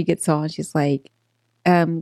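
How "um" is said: ordinary delivery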